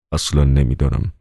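usual speaking voice

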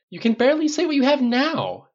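very high, loud exasperated protest. A narrator's reading. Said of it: "he protested".

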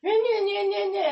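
high, loud mock sobbing nah; mock crying. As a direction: whine it like a crying friend. Mimicking the crying friend.

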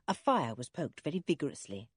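usual speaking voice

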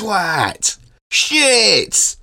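very high, stretched comic exclamations; mock Batman effect. Podcasters riffing on rude Batman captions, high mock sound effects. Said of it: "Bring back, uh, the old Batman".

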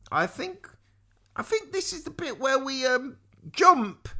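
put-on high, halting girl's voice; falter, then push out the last word; nervous hesitation. A narrator's reading. Eliza at the drawbridge edge, high and hesitant. Girl hesitates before a frightening jump.

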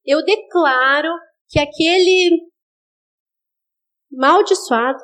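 slow, begrudging prayer that slips into a curse; a hint of spite in a dragged-out prayer; grudging mock prayer. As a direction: pray through your teeth, almost cursing him. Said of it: "Você fala assim, de noite no teu quarto, assim, em voz audível".

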